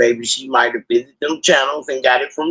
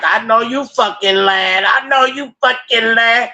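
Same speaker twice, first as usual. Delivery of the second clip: imitating cousin; 7 semitones higher than usual. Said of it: "my cousin was like".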